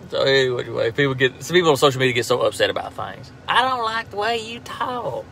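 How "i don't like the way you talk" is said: high, drawn-out mock grievance; mock complaint. Host mimicking people upset on social media, mock complaining. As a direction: imitate an upset commenter, slow and softer.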